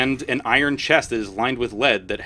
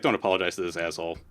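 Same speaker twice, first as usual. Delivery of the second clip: low, quick muttered contempt; grumbling warrior dismissing the noblewoman; gruff dismissal; 7 semitones lower, 7 dB quieter, much faster than usual. Acted. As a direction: brush her off under your breath. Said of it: "he's like".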